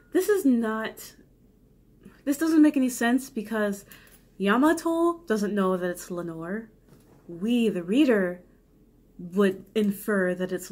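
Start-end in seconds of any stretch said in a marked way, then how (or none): none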